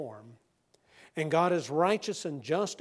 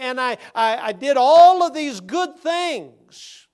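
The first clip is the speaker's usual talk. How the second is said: high, loud whining defendant who builds to a shout; pleading criminal; 7 semitones higher, 9 dB louder than usual. Retold or imitated. Criminal pleads with the judge over church deeds, whining louder. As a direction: plead your good deeds desperately to the judge. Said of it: "this individual said".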